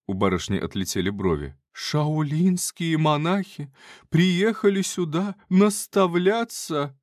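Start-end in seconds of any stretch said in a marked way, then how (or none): 1.7-7.0 high, slow incredulous lady; astonished lady. Acted. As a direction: gasp it out in disbelief. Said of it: "astonished lady".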